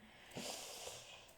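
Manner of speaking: long, quiet exhale; long sigh. Acted. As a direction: let out a soft, drawn-out sigh. Wordless sigh before objecting.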